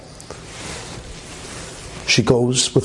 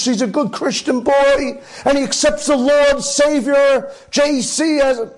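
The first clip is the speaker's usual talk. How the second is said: much higher, slow, imploring voice; high pleading mother; 12 semitones higher, slower than usual. Retold or imitated. Mother begging the priest to baptize her son, high and slow.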